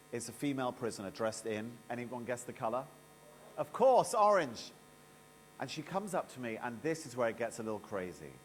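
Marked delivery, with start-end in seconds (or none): 3.6-4.7 punchline. Real, not acted. Answering own quiz to audience.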